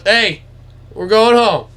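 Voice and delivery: high, loud send-off to deer; imitating dad calling to animals. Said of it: "And he goes".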